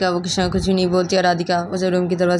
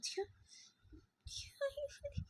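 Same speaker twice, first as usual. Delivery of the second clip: whisper; 27 dB quieter, much slower than usual. Acted.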